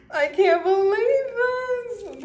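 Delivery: high loud slow near-sobbing; real emotional wail; overwhelmed cry. Real, not acted. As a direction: cry out in disbelief.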